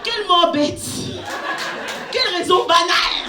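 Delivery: hammed-up dismay; mock lament. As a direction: wail at the silly death.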